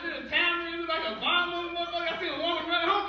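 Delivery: ordinary delivery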